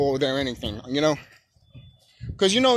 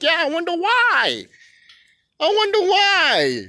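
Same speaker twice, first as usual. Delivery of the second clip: high, slow, singsong mockery; exaggerated sarcasm; 12 semitones higher, 4 dB louder, slower than usual. Answering mom's boyfriend, heavily sarcastic. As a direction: stretch it out, high and taunting.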